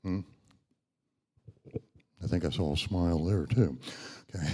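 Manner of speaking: ordinary delivery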